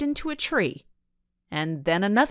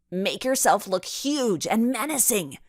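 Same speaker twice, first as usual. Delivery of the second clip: urgent cry; 4 semitones higher than usual. A narrator's reading. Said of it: "Ava cried out".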